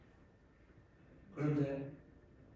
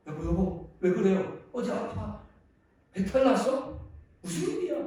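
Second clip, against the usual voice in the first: higher, rising worried questions; alarmed wife; 5 semitones higher, 6 dB louder than usual. Acted. Wife finding her husband rolling on the floor, acted alarm. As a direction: play a startled wife firing anxious questions.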